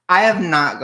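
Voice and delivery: I have not, said loud and high, hard on have; loud protest. Real, not acted. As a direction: burst out in loud disbelief. Exclaims at surprising news.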